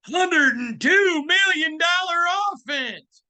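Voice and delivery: high, slow, shouted hype; hyped shout. Real, not acted. Host fires up Raider fans about the offense, slow high shout. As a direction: bellow it out to fire up the fans.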